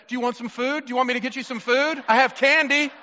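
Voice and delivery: big sister bribing. Retold or imitated. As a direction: sweet-talk the crying sister. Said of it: "The first thing she said".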